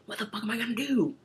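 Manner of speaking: exasperated outburst. Retold or imitated. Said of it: "just like".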